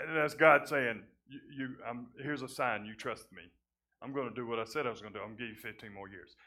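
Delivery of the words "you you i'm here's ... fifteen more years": quiet voice of God. Retold or imitated. God's words quoted to Hezekiah.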